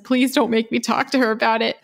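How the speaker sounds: a hint of a mortified student pleading. Student begging his professor not to call mom, mock plea. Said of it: "And the student was like".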